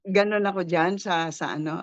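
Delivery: ordinary talk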